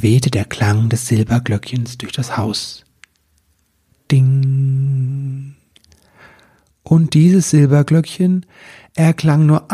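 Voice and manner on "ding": long, level ringing "ding"; drawn-out imitated bell ding; bell chime. A narrator's reading. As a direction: hold the bell note and let it fade.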